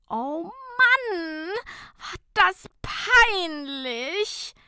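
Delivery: high, drawn-out wail; whining lament. A narrator's reading. Fabienne wailing to Nicola after the embarrassment, slow high whine. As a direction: moan it slowly as if near tears. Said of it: "jammerte Fabienne".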